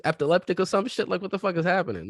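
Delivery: exasperated rant. Real, not acted. Exasperated rant about friend.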